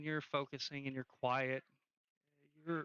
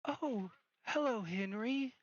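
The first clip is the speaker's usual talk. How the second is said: put-on high-pitched ghost girl; raised, lilting voice of a girl's spirit; Yella; 7 semitones higher than usual. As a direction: greet an old acquaintance in a higher voice. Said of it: "Yella comes out, and she's like".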